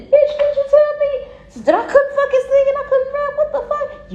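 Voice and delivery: high-pitched furious disbelief; outraged shriek. Acted. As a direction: scream it at the hater. Outraged at the doubters.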